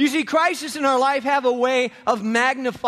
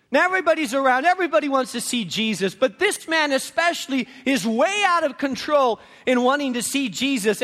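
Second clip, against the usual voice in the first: normal talking voice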